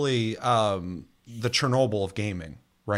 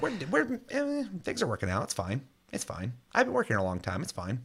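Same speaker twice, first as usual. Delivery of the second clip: mock Todd Howard; 3 semitones higher, 7 dB quieter than usual. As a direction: play a nervous boss insisting all is fine. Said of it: "And Todd Howard's like".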